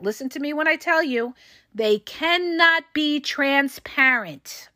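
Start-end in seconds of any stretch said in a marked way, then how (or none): 1.7-4.7 very slow, spaced-out "cannot be transparent"; deliberate emphasis. Real, not acted. Coach telling listeners to listen, slowly stressing "cannot be transparent". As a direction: drive home each word of "cannot be transparent".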